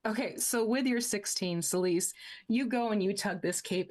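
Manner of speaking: ordinary delivery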